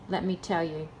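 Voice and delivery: ordinary talk, nothing marked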